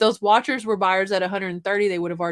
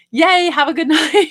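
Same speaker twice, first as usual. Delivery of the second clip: high, loud cheery send-off with a laugh; squealing a happy goodnight to a viewer; excited goodbye; 8 semitones higher, 10 dB louder than usual. Real, not acted. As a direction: cheer them off into the night.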